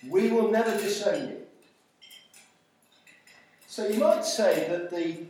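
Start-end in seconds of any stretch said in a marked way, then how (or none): 0.1-1.5 louder, raised collective vow; firm, lifted declaration; emphatic insistence. Acted. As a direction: pledge it firmly for the whole group. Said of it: "all the others said the same".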